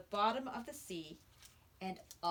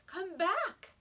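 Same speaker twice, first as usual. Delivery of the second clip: higher, louder call; 9 semitones higher, 6 dB louder than usual. A narrator's reading. Brother calling the boy back, calling out. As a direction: shout for him to return.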